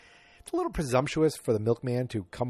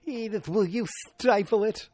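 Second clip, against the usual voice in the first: slow, high, drawn-out poor impression; Archie Bunker; 10 semitones higher, 4 dB louder, slower than usual. Host trying the husband's catchphrase on the wife, clumsy exaggerated impression. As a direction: overstretch the catchphrase in a bad grouch voice. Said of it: "That wasn't good at all".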